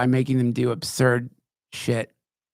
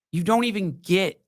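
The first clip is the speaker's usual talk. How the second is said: normal talking voice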